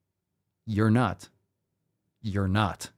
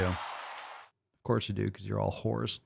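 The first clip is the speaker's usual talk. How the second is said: slow, much softer mock-sinister murmur; creepy murmur; 3 semitones lower, 10 dB quieter, slower than usual. Streamer teasing his chat, slow creepy hushed murmur. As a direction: drag it out low and quiet like a creep.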